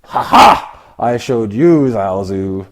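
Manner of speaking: mock victorious gloat. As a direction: crow over your rival like you just won. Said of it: "Is he gloating?".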